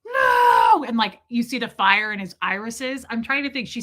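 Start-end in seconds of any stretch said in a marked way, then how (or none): 0.0-0.8 sudden high shout; high loud outburst. Retold or imitated. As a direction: yell it out. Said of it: "he goes".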